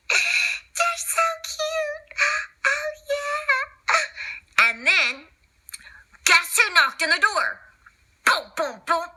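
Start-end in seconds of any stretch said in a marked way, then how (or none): 0.0-4.7 much higher, very slow, laughing coo. Acted. Someone coos over the cute newborn puppies, high slow laughing coo.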